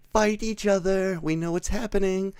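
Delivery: high falsetto mock complaint; impatient heckle. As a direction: play a bored viewer calling at the screen. Viewer's impatient heckle at screen.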